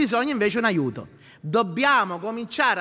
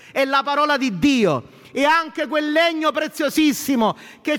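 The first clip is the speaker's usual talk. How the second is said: full-force preaching; 6 semitones higher than usual. Acted. Pastor rousing the church with God's word, full-force preaching. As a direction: preach it out to the congregation.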